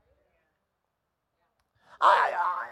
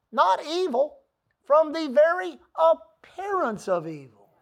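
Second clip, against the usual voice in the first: slow emphatic reading; 2 semitones higher, slower than usual. Acted.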